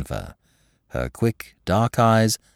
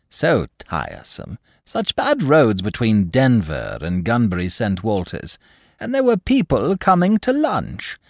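imperious plummy grande dame; 3 semitones higher than usual. Acted. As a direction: gossip like a grand old lady. Duchess gossips through the inquest.

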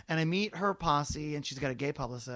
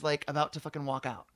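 ordinary talk, nothing marked

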